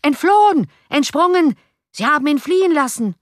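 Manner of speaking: high, loud cries of outrage; angry shouting. A narrator's reading. Enraged faces at the windows shouting of the escape, angry shouts. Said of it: "vor Zorn glühende Gesichter ... und schrien".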